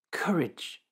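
whispered reassurance. Acted. Jesus calms frightened disciples.

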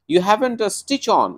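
mother's warning. Acted. Mother warning her undressed son. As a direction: point it out like a worried mother. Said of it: "she pointed out".

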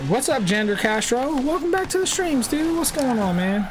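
high, happy streamer hello; excited greeting. Real, not acted. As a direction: greet your buddy with a big lift. Greeting returning viewer.

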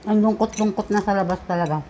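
talking between bites; words muffled by food; mouth full, chewing. Real, not acted. Seen 2: Woman's lips work around food between forkfuls while speaking.

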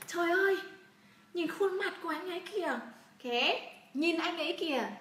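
high, giddy swooning; smitten gushing. Acted. Teacher translating the girl's reaction to his face, high smitten gushing. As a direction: swoon over him in a high voice.

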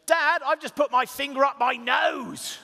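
put-on high, loud, slow kid call; child shouting again. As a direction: shout it proudly like a small boy. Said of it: "he shouts again".

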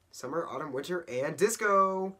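loud, high party whoop; hammed-up disco enthusiasm; showy excitement. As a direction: cheer them on to join the dancing. Mom shows off disco tree.

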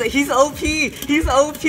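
high, loud excited squeal. Real, not acted. Vlogger excitedly calls the bey overpowered, high and loud.

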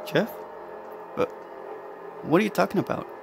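sleepy mumble; mumble, barely awake; half asleep. A narrator's reading. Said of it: "Louis mumbled, half asleep".

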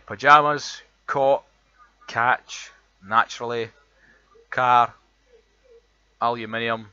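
reads each word out slowly and loudly in a broad Edinburgh accent; Edinburgh accent word list. Shown as a demonstration. Reading a word list to show his Edinburgh accent, loud and deliberate. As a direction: lays every word out clearly, showing off the Edinburgh sound. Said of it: "the words that we've got".

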